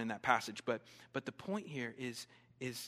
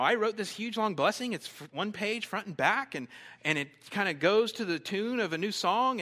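loud, higher-pitched mock self-important insistence; self-absorbed gushing; 7 semitones higher, 10 dB louder than usual. Friend barging in on sleepers with a long blessing, mock self-absorbed. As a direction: play an oblivious friend, loud and pushy.

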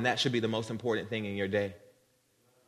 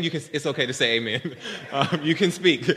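chuckling through the words; real amused laugh while coaxing; laughing encouragement. Real, not acted. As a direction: tease a silent audience with a laugh. Teasing a silent congregation for response.

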